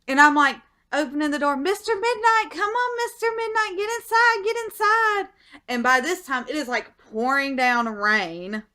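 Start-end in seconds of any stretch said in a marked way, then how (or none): 1.6-5.3 very high-pitched, urgent pet call; urgently calling cat. Retold or imitated. Owner calling cat in from the aliens, high urgent call.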